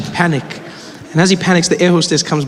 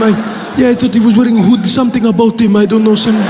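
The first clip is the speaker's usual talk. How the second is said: higher, hesitant Middle Eastern voice; apologetic Middle Eastern man, raised pitch; Middle Eastern accent; 3 semitones higher than usual. Acted. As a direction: interrupt politely and haltingly in a Middle Eastern accent. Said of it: "a Middle Eastern man".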